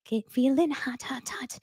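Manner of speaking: breathy mock whisper. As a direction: whisper it like a secret come-on. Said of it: "I'm getting hot".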